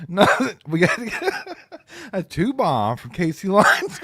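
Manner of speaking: words squeezed out through giggles; laughing. Real, not acted. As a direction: try to read while cracking up. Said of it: "You're just done right now".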